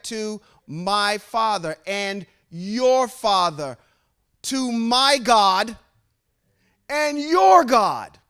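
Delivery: preaching climax, slow and rising; building slow climax. Acted.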